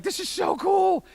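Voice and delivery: high, drawn-out gushing delight; acted excitement. Traveler marveling at hearing Thai around him, high excited gush. Retold delight at foreign language.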